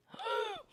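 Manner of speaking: sharp, high intake of breath; startled gasp. Real, not acted. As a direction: gasp in sudden alarm. Startled by unknown creature.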